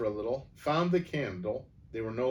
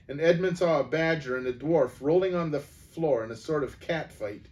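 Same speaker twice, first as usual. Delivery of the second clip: ordinary delivery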